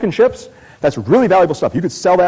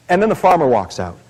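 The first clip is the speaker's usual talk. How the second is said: ordinary talk, nothing marked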